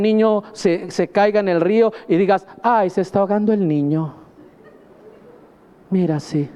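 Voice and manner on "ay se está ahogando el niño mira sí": casual, unbothered report of danger; indifferent. Retold or imitated. Preacher imitating a bystander, calm and unbothered. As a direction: say it as if it hardly matters. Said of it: "Es diferente".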